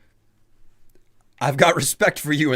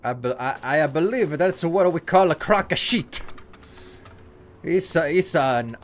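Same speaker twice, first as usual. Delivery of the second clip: mock Italian accent; 5 semitones higher than usual. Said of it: "Italian barista".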